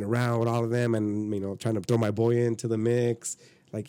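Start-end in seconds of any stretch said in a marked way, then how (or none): none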